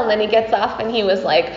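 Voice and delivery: ordinary delivery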